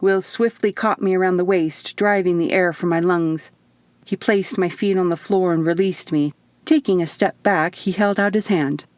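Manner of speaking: ordinary delivery, nothing marked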